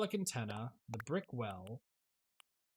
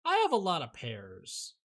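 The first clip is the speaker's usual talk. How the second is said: louder, stress on "have"; 4 semitones higher, 8 dB louder than usual. Real, not acted. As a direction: say it loud and surprised. Repeats the phrase.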